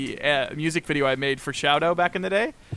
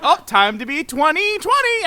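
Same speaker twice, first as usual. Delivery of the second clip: high, louder cartoon voice for the stick figure; playful mock voice; 13 semitones higher, 9 dB louder than usual. Animating his old stick figure, a higher cartoon voice. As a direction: voice the little figure with a chirpy high tone. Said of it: "And then I would, like".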